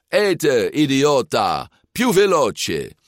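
high, drawn-out bullying shout; angry urging. A narrator's reading. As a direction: bark impatient orders at a slow eater. Bully forcing teammate to eat faster.

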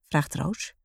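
usual speaking voice

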